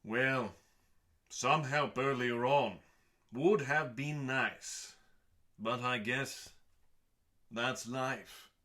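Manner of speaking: pointed, slowed "would have been nice"; passive-aggressive. Acted. Host needles guests who helped too late, passive-aggressive. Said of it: "The tone for this sentence can be fully passive-aggressive".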